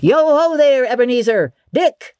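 high, rich, jovial holler; jovial calling voice. Acted. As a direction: call out in a comfortable, oily, fat voice. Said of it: "called out in a comfortable, oily, rich, fat, jovial voice".

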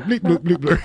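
mock alien chatter. Co-host mimicking aliens placing rocks, mock alien chatter. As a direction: bleep away like a silly little alien. Joking imitation of alien builders at work.

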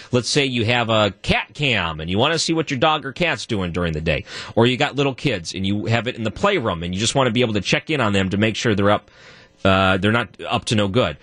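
ordinary talk, nothing marked

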